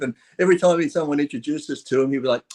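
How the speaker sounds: ordinary talk, nothing marked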